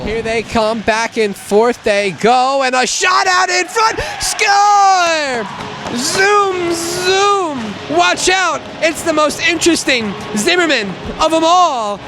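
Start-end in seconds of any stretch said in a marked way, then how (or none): 2.6-12.0 high shouted goal call; long goal yell. Real, not acted. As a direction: yell it as it goes in.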